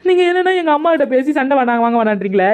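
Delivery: high and aggrieved; wounded protest to her husband; hurt complaint. Acted. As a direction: let the hurt push the voice up. Wife upset, complains to husband.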